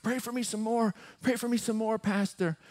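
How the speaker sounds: high, pleading retold request; imitated weeping plea. Man begging his pastor for prayer, imitated weeping. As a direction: beg as the weeping man would. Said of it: "He is just weeping".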